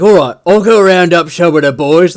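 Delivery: keen orc. Retold or imitated. Orc offering the boss to gather the boys, loud. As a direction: off to fetch the lads, all gusto. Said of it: "the orc is just like".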